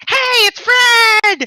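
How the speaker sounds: high-pitched screamed catchphrase; Fred. Retold or imitated. Mimicking the internet celebrity on YouTube, high screaming impression. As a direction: shriek it in a loud, high put-on voice. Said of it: "him screaming for an hour".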